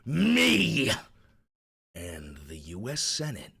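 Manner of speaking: theatrical reading, swelling to a shout then sinking to a whisper; dramatic reading. Shown as a demonstration. Streamer reads Trump's post as a dramatic performance. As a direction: read it like a grand dramatic monologue. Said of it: "I wanna do a dramatic reading".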